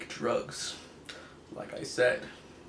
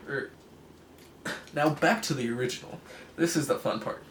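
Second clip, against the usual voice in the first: ordinary delivery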